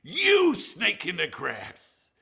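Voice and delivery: high, loud, slow mock-furious shout; Ollie's fury. Ollie accusing Stanley, slow hammed-up furious shout. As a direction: spit the insult out, building. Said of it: "He goes".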